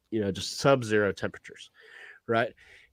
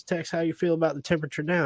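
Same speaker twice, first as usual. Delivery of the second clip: quick, higher, lightly Southern devil; Southern accent; 6 semitones higher, faster than usual. Acted. Devil checking on Tex once more, light Southern accent. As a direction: hurry the question with a touch of Southern. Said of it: "has a Southern accent".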